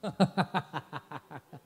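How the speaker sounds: real amused laugh; burst of laughter. Real, not acted. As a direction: crack up laughing. Laughs at crouching man joke.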